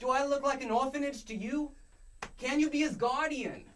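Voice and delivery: hammed-up Boston accent. Shown as a demonstration. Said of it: "I need to hear it".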